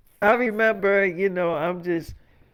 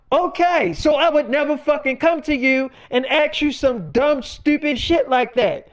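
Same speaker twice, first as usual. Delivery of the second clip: acted-out angry comeback, higher pitched; retold indignant retort; 6 semitones higher, 3 dB louder than usual. Partner answering David's admission, indignant. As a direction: throw the point back at him, pitched up.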